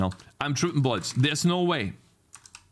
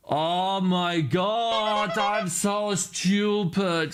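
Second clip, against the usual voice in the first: slow, drawn-out, higher-pitched groan of words; self-berating wail; 7 semitones higher, much slower than usual. Real, not acted. Coder spotting their own silly mistake, slow self-berating wail. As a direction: wail it slowly at yourself.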